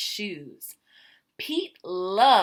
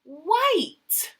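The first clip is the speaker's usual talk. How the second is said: excited; 8 semitones higher, 6 dB louder than usual. Acted. Kids' answer called out.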